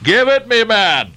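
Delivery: high, impatient captain's order; commanding cry. A narrator's reading. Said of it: "cried Ahab".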